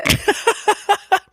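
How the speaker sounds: high-pitched burst of laughter; laughing. Real, not acted. Reacting to friend's flustered explanation.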